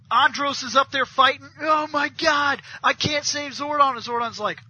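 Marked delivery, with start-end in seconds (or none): 1.6-3.9 mock anguish. Retelling Andros fighting to save Zordon, mock-desperate. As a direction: wail it like an overdone hero.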